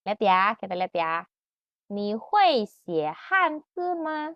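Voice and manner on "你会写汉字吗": "你会写汉字吗" slow, higher, tones clear; slow model question. Shown as a demonstration. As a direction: model for copying. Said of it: "Ikutin, Kak Rika".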